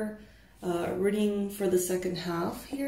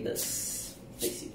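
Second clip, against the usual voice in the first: whispered; 14 dB quieter than usual. Real, not acted. Softly addressing viewers.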